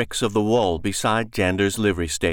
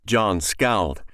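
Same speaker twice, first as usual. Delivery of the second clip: ordinary delivery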